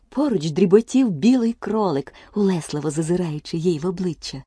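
usual speaking voice

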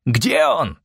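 high, searching shout at moderate volume; distant yell. A narrator's reading. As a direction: call out as if from far above. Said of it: "заорали далеко вверху".

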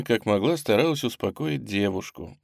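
usual speaking voice

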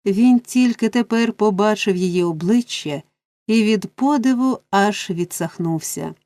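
plain reading voice

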